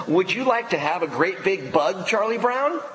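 teasing Lucy, rising high on Charlie Brown; sly cartoon girl asking sweetly; Lucy's voice. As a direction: bait him like a bossy little girl. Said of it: "And Lucy said".

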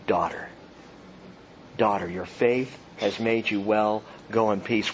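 low, soft and tender; tender, low address. Acted. Preacher voicing Jesus blessing the healed woman, low and tender. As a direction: speak it gently like a blessing.

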